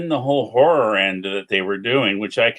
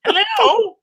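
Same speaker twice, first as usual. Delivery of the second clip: much higher, hammed-up hello; exaggerated phone hello. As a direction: overdo a squeaky phone greeting. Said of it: "He didn't sound like that".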